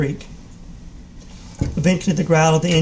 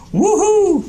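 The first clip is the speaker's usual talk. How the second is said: whooping shriek, very high and loud; gleeful yell; 5 dB louder than usual. A narrator's reading. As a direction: let out a wild, delighted whoop. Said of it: "he yelled".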